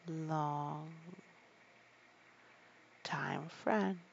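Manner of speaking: ordinary delivery, nothing marked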